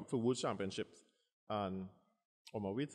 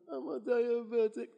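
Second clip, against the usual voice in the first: mock self-pity; 11 semitones higher, 8 dB louder, slower than usual. Retold self, fearful.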